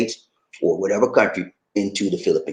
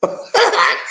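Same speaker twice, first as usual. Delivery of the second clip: high laugh; real high laugh; 3 dB louder than usual. Real, not acted. Laughs at his joke.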